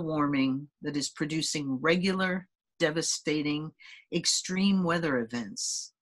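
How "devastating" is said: ordinary talk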